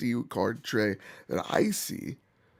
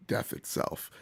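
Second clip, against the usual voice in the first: voiceless rasp from bronchitis; hoarse whisper; 7 dB quieter than usual. Real, not acted. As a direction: rasp it out, sick voice. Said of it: "my voice sounds like".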